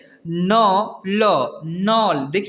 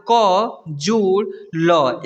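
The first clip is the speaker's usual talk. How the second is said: letters joined very slowly; slow spelling out; much slower than usual. Shown as a demonstration. Teacher spelling a word for pupils, very slowly. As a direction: sound out each letter very slowly. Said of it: "यानी जोड़ का मतलब".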